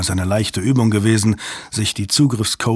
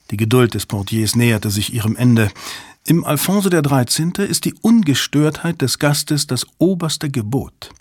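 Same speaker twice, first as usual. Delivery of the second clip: usual speaking voice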